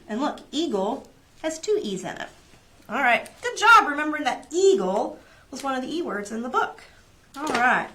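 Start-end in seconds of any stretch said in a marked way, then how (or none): none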